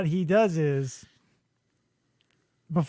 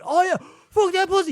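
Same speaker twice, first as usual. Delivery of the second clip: mock screaming grip; 10 semitones higher, 3 dB louder than usual. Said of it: "he's screaming".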